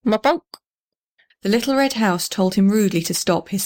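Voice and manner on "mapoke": high hooting owl call. A narrator's reading. Owl calling in the night tree, hooting. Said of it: "began to call".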